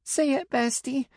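put-on high child voice with a touch of tears; a little girl mumbling through mild tears; tearful little girl mumble. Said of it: "Stella mumbled, tears in her voice".

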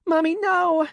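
put-on very high, loud little-girl cry of no; child wailing over her dead mother; anguished child's scream. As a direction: wail it in despair. Child sees mother killed.

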